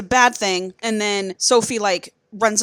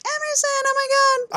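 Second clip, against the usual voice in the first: retold gasp of shock, pitched way up; mock shocked cry; much faster than usual. Lover bursts in on her.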